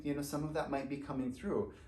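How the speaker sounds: ordinary talk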